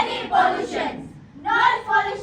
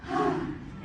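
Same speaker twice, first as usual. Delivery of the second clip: breathy sigh; 9 dB quieter than usual. Acted.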